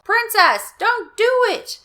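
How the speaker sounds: ordinary delivery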